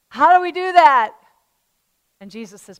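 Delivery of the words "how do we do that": dismayed Peter. Retold or imitated.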